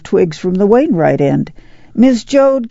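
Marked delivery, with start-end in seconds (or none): 1.9-2.7 high-pitched neighborly call. A narrator's reading. Neighbor calls to Ma from the other end, calling out. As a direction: call across from the far end. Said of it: "came the call".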